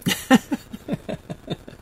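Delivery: real airy laugh, voiceless and high; breathy teary laugh. Real, not acted. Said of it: "Oh, I'm ridiculous".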